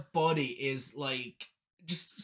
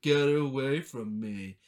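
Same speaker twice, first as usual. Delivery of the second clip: slow, lower mocking imitation; mock her back, drawn out and lower; mocking echo; 4 semitones lower, slower than usual. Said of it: "he starts mocking her".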